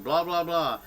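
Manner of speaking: ordinary talk, nothing marked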